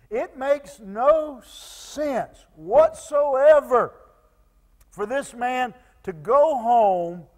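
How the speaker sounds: emphatic preaching. Real, not acted. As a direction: proclaim it slowly and forcefully to the whole church.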